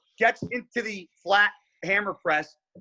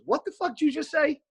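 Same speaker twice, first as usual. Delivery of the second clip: high, sharp disbelieving challenge; squaring up to a rude stranger; angry challenge; 10 semitones higher than usual. Retold or imitated. Buddy confronts the kid, high and indignant. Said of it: "My buddy Rich goes".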